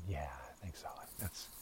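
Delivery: barely audible whisper; voiceless aside. Real, not acted. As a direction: breathe it like a secret. Conspiratorial answer to own question.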